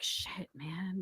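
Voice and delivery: hushed whisper while hiding. Retold or imitated. Kid hiding from his searching mom, whispering. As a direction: breathe it out under your breath.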